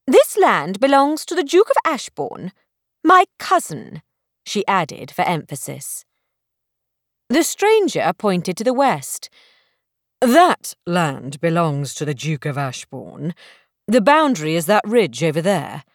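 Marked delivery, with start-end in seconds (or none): 0.1-4.0 put-on higher, offended young lady; indignant. Belle insisting the land is her cousin's, indignant. 10.2-15.8 lower, unruffled gentleman's voice; calm correction. Acted. Stranger calmly correcting Belle about the boundary, lower and cool.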